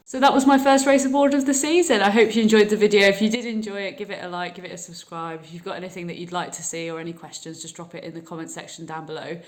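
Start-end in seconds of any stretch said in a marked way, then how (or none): 0.1-4.3 vlog outro. Acted. Video outro.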